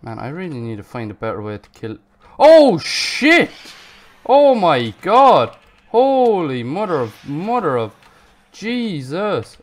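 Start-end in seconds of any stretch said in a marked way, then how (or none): 2.4-9.6 real panicked yell, much louder and higher, slowly easing; alarmed shouting. Real, not acted. Player suddenly attacked in game, alarmed shouting. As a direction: shout in shock, drawing out the oaths.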